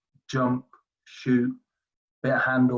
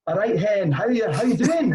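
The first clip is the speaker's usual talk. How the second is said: higher-pitched broad Glaswegian greeting; broad Scottish; 7 semitones higher, 3 dB louder than usual. Retold or imitated. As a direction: put on a thick Scottish accent, pitched up. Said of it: "broad Scottish".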